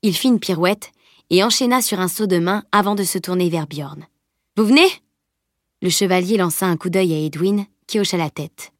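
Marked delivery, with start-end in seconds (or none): 4.6-4.9 appel joyeux. A narrator's reading. Salim appelle joyeusement Bjorn.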